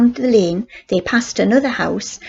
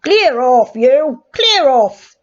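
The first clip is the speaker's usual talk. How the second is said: put-on loud, gruff shout at the children; policeman's shout; 4 semitones higher, 5 dB louder, slower than usual. A narrator's reading. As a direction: bark them away like a cross policeman. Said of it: "shouted at them".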